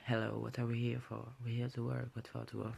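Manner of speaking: imitating a strict teacher in French-accented English; mock military English teacher with a French accent; English drill patter, heavy French accent. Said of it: "excusez-moi pour mon accent".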